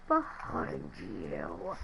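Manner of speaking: very deep, slow, soft demon voice; demonic voice. Retold or imitated. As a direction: sink it low and slow like something behind you. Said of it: "in all them voices".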